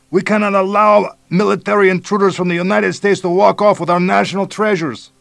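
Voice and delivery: put-on high, loud bellow with Mexican accent; shouting. A narrator's reading. Police chief bellowing at American invaders, loud shout. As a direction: bellow it from the jungle. Guerrilla leader shouting demands.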